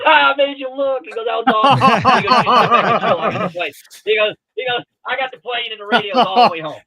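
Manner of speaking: high, loud, laughing gotcha; laughing tease. Retold or imitated. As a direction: crow with laughter over the prank.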